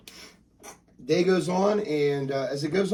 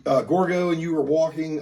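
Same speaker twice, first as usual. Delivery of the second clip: ordinary talk, nothing marked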